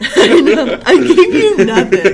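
loud laughing delivery. Real, not acted. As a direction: burst out laughing as you speak. Laughing at her guess.

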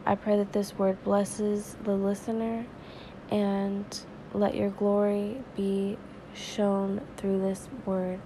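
ordinary talk

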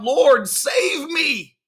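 high, drawn-out shout for help; acted panicked plea at a sustained high pitch; desperate cry. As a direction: cry out as if sinking, long and high. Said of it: "and he cried out".

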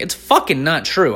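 ordinary talk, nothing marked

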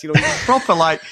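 usual speaking voice

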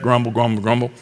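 low, soft grumbling mutter; muttering under breath. Shown as a demonstration. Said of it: "kind of under your breath".

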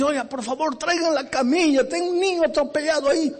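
high, rushed cry for help; urgent plea. Acted. Driver begging the clinic staff, high and urgent. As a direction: beg them to bring the stretcher fast.

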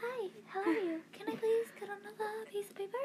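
gently mock her timid talk to adults; teasing the sister's timid adult voice; mock polite voice. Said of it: "you're like".

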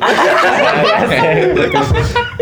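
breathy high-pitched laugh bursting out; an airy shriek of laughter; high squealing laugh. Real, not acted. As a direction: laugh at your own embarrassment. Embarrassed memory, big laugh.

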